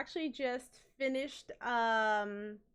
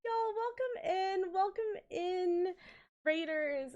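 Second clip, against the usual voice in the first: high squealing greeting voice; cute anime streamer; 9 semitones higher, 4 dB louder than usual. Acted. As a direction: squeal out the welcome. Hyped greeting of raiders.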